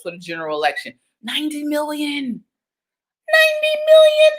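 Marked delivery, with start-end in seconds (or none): 1.2-2.4 quieter, stated plainly; soft statement. Shown as a demonstration. Host telling viewers the vote count, softly. 3.3-4.3 high, loud squeal of amazement; amazed shout. Shown as a demonstration. Host marveling at the vote count, loud and high.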